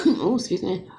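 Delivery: coughing after food went down wrong; choking. Real, not acted. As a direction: choke a little, then excuse yourself. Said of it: "Went down the wrong pipe".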